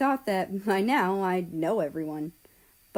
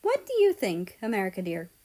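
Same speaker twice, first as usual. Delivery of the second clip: mother voice; faster than usual. Acted. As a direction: coax it out of her child. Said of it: "she asked me".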